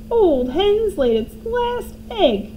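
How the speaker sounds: high, slow crone's call; grandma shouting from the rooftop. A narrator's reading. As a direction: call it out with mocking relish. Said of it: "Grandma shouted".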